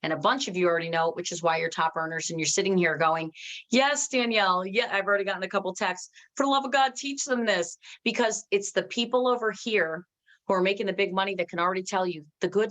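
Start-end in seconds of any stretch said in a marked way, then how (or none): 3.7-7.8 imitated exasperated urging. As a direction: plead like an eager insider. Voicing top earners urging her on.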